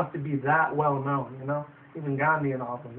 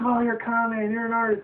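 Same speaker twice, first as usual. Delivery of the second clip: higher, mocking crowd voice; lightly voiced heckler, higher; 7 semitones higher than usual. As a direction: imitate a jeering audience member. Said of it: "It's like".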